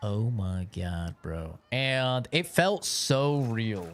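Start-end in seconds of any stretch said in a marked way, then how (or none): 0.0-1.6 stunned shock. Retold or imitated. Reacting to the shooting.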